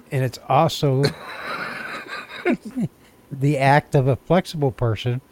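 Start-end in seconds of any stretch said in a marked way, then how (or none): 1.1-2.9 silent wheezing laugh; airy laugh that loses its voice; breathless wheezing laugh. Real, not acted. Cracking up at the definition.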